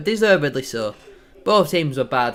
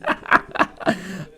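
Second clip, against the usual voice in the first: gleeful laugh; 4 semitones higher than usual. Real, not acted. Laughing at rival's tears.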